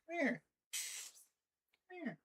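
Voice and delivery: very high, cooing pet voice; baby talk to cat. Acted. Streamer cooing at her meowing cat in high baby talk.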